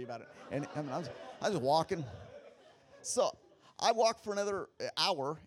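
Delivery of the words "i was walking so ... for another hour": laughing through the words; amused laughter. Real, not acted. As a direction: chuckle as you tell it. Laughs while telling.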